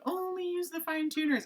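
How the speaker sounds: high singsong self-quote; mimicking own catchphrase. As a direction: chant it high, like a much-repeated rule. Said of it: "you always hear me saying".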